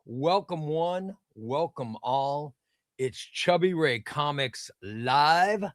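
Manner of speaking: slow, soft show announcer intro. Real, not acted. Streamer welcoming viewers to his live show, slow announcer style. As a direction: roll out the welcome slowly like a showman.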